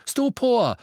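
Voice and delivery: high, loud spell cry; shouted spell. A narrator's reading. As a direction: fire the spell out. Harry casts a spell again.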